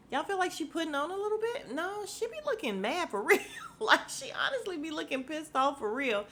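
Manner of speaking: high, quick, gleeful question to the viewers; excited gossip. Real, not acted. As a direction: pitch up and rush it like juicy gossip.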